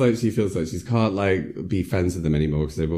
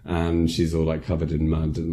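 ordinary delivery

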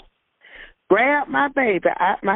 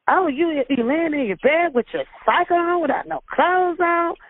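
a hint of a scolding officer, higher; mock holler; 8 semitones higher than usual. Officer scolding the half-dressed woman, mild mock holler. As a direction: sneer it at her, pitched up. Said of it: "She go and holler".

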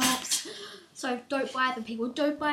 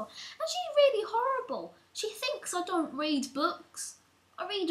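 indignant rant; 8 semitones higher, 4 dB louder, slower than usual. Real, not acted. Ranting about horrible teacher.

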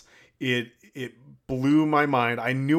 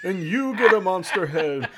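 Oprah impression; 6 semitones higher, 4 dB louder than usual. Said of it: "I'm imitating Oprah?".

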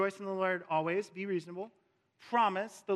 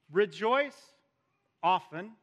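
loud rising "Rejoice", pause, then "often"; mock letter, qualifying the command after a pause; mock hedged command; 2 semitones higher, 6 dB louder than usual. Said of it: "if I was writing this letter".

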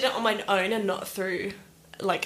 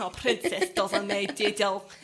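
slow, softer Scottish line with a Kiwi tinge; Scottish accent with Kiwi; 6 dB quieter, slower than usual. Retold or imitated. Guest repeating the Brave quote, slow Scottish with a hint of Kiwi. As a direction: say it again in Scottish, quieter. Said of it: "Straight with a little bit of Kiwi".

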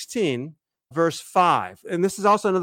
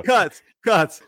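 higher, louder playful call; jokey raised-voice interruption; mock director's cut call; 6 semitones higher, 7 dB louder than usual. Mock order to edit it out.

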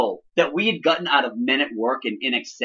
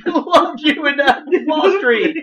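high-pitched, cracking up while quoting; laughing movie quote; 11 semitones higher, 4 dB louder, slower than usual. Retold or imitated. Retelling a Hot Shots scene to co-hosts, laughing. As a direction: squeeze the quote out through laughter.

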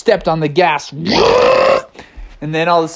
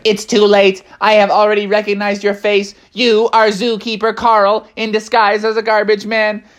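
higher robotic monotone; Techno Bear; 4 semitones higher than usual. Acted. Robot bear unmasks Zookeeper Carl, monotone robot voice. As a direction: pronounce the verdict like a machine. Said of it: "Techno Bear was like".

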